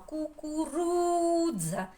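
«Кукурудза» high and slow, stretching stressed РУ; протяжний наголос. Shown as a demonstration. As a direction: draw out РУ in «Кукурудза», then a short ДЗА. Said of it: "Не дуже співаємо". (високо, протяжно на РУ, коротке ДЗА)

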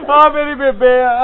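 slow, loud mourning cry; acted grief. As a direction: cry it out slowly in hammed-up grief. Grieving son points out his mother. (ਸੋਗ ਵਿੱਚ ਉੱਚੀ ਦੁਹਾਈ)

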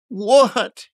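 high, loud outraged cry; narrated scream of disbelief. A narrator's reading. Drunken youth outraged at the merchant, screaming. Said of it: "he screamed".

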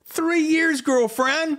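thrilled, high exclamation; excited celebrating. Real, not acted. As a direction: cheer your friend in a very high voice. Celebrating anniversary with friend.

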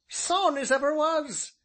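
high, loud rustic elder; high-pitched ancient countryman, proud; emphatic old man. A narrator's reading. Voices the ancient.

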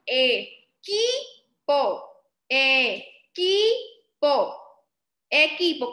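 "equipo" split into E... KI... PO; long pauses inside "equipo", KI punched; syllable by syllable. Shown as a demonstration. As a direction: sound out "equipo" in three separate beats. Teacher sounds out word by syllables.

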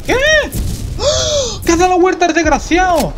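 real startled outburst; surprised shout. Real, not acted. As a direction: yell it out in shock at the screen. Enemy turned around mid-game.

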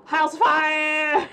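loud mock alarm call; loud shout. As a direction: yell it like a fire alarm.

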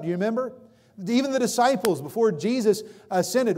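ordinary delivery

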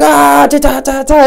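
high, loud imitated rapid gunfire yell; mock screaming. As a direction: yell ta ta ta like a kid playing shooter. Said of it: "starts screaming".

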